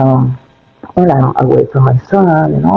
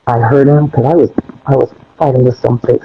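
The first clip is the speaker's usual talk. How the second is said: normal talking voice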